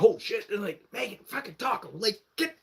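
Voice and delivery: high-pitched, urgent re-enactment; high, flustered cursing; mock panic. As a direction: urge friends inside in a retold scramble. Scrambling to hide from Justin.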